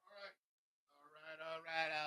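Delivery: ordinary talk, nothing marked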